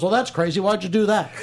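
a hint of amused Johnny Carson; lightly voiced Johnny Carson. Johnny Carson answering Scatman with a hint of amusement. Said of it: "my impression of Kevin Pollak's impression of Albert Brooks".

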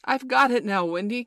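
loud, high triumphant call; excited cry. A narrator's reading. John thinks he can fly, crying out to Wendy. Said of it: "cried John".

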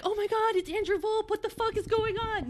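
high, soft retold inner voice; stunned, not panicking. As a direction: softly squeal an awed thought. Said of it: "Not panicking, just being like".

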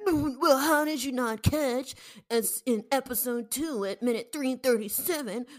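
high, loud, whiny nitpicker; mocking fan voice. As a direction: play an outraged know-it-all fan.

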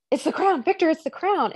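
insisting to Victor that it's the crown; urgent hint. Retold or imitated. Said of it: "She's like".

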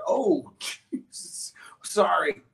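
high, drawn-out stunned exclamation; mock awe, slow and high; retold shock. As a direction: imitate her stunned apology, stretched out slowly. Lady shocked on seeing bear, retold.

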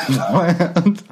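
cracking up mid-line; can't keep a straight face; laughing. Real, not acted. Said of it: "not gonna be able to finish this with a straight face".

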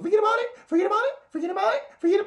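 very high, loud repeated squeals; overdone mock shriek. Mocking today's overuse, high loud squeals. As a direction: squeal it again and again. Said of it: "Now it's like every fucking".

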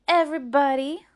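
very loud, high retort; insistent taunt. Acted. Rose repeating her answer in a quarrel, insistent taunt. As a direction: insist on it loudly.

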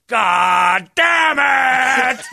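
very high, loud, drawn-out shout of disbelief; dad's waking yell. Retold or imitated. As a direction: bellow it like a man jolted awake, lost in the woods. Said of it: "you just hear him go".

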